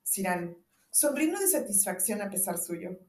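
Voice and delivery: ordinary talk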